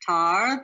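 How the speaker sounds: Tar said alone as a separate syllable. Shown as a demonstration. Teacher sounding out the first syllable. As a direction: give Tar on its own, then pause. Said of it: "The second word".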